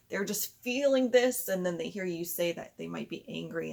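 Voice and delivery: usual speaking voice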